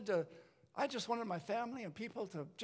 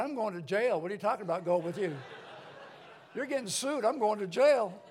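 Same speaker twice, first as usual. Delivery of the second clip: mock panic; 3 semitones higher, 5 dB louder than usual. Panicking after the fight.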